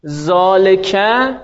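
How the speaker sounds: ذالکه said slowly as zɔlekæ, syllable by syllable; ذالکه drawn out with a rising end; slowly sounded-out ذالکه; modelling Arabic. Shown as a demonstration. Teacher models Arabic word.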